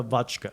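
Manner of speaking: "vodka" said as VOTCH-kuh; imitated "vodka" with a ch for the d; Mary's pronunciation. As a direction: put a "ch" in the middle of "vodka". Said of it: "pronounces vodka".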